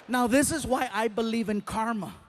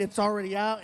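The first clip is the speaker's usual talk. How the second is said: normal talking voice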